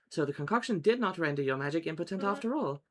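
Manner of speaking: composed hypnotist character, formal and articulated; Mesmer. Acted. Mesmer closing the first session, mildly relieved. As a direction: wrap up the lesson like a measured magic tutor.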